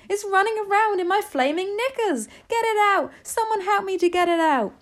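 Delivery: high, loud comic panic; screaming. A narrator's reading. Cook begging kitchen staff to remove mouse, screaming. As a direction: plead for rescue at a high-pitched yell. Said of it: "the man was screaming".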